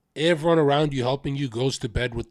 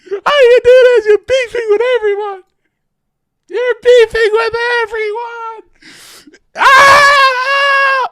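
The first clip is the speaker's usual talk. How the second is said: much higher, louder wailing sob in a put-on voice; hammed-up hysterical crying ending in a shriek; mock crying; 16 dB louder than usual. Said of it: "He's like".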